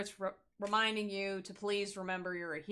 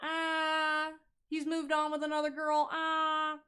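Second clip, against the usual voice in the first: higher, whiny fake-over-it voice; mock a pining ex, pitched up; 6 semitones higher than usual. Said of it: "Not the fake".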